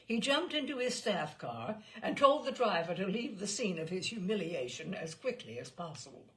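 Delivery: ordinary delivery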